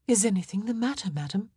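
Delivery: a touch of anxious care; concerned question. Acted. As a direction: ask your mistress with mild concern. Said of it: "looking concerned".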